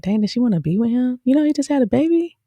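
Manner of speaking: high, fast disbelieving muse; incredulous aside. Retold or imitated. Wondering if she likes the new father. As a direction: wonder aloud, half shocked. Imagined inner reaction to TV scene.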